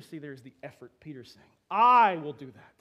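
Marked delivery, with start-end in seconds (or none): 1.7-2.7 loud, slow, desperate promise; fervent insistence. Acted. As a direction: insist with all your heart. Peter insists on his loyalty.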